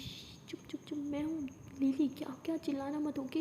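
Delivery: hushed, urgent shushing; दबी आवाज़ में चुप कराना. A narrator's reading. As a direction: shush her under your breath. Hushing her before she screams. (दबी, फुसफुसाती आवाज़ में चुप कराते हुए)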